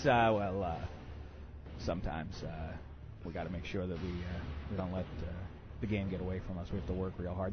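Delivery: low, soft, monotone coach-speak; dull coach impression. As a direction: drone like a coach with no fire. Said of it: "Not the most exciting, uh, public speaker".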